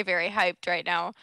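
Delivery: ordinary talk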